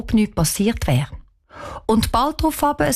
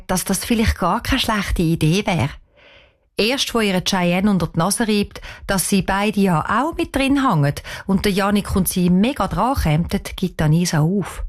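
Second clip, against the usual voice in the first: ordinary delivery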